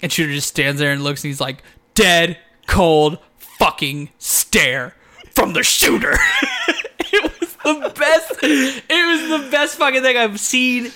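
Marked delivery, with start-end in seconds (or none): 1.9-6.2 slow, separated words; punchy, drawn-out wrestler impression; imitated dramatic announcement. Imitating Moxley's dramatic line, drawn out. 6.2-10.9 high, laughing delight; helpless giggly enthusiasm; cracking up. Real, not acted. Cracking up over the retold moment.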